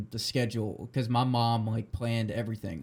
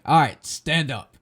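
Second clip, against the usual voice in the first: mock barked order; 3 semitones higher, 7 dB louder than usual. Retold old man's commands.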